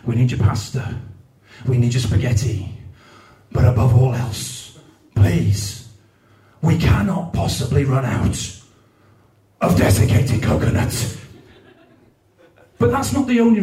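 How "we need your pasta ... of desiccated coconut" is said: mock charity advert. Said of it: "adverts for any food banks that say".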